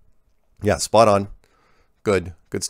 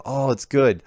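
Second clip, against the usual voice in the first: ordinary talk, nothing marked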